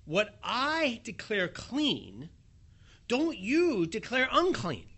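the Lord warning Peter, drawn out and stern; slow and emphatic. Acted. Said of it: "the Lord then says to him".

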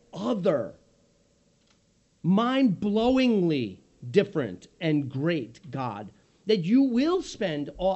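ordinary delivery

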